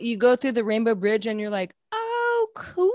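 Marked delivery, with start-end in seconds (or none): 1.9-2.9 very high-pitched delighted discovery; pretend wonder. Imagining arrival over the Rainbow Bridge, pretend awed delight. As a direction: coo it high, as if pleasantly amazed.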